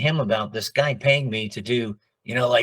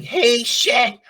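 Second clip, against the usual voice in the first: much higher cartoon dog voice calling Shag; squeaky cartoon dog yelp; Scooby-Doo impression; 12 semitones higher, 4 dB louder than usual. Shown as a demonstration. Client's answering machine gag, high Scooby voice. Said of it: "Shaggy and Scooby".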